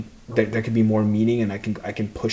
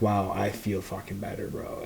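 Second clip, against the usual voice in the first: softer, hushed relief to himself; quiet inner voice; 2 semitones lower, 9 dB quieter than usual. Retold or imitated. Fighter recalls car ride home, softer, hushed relief. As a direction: murmur it as private relief. Inner voice after gratitude switch.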